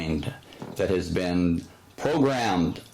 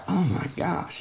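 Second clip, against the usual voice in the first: exasperated; 9 semitones higher than usual. Retold or imitated. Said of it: "you can imagine the frustration".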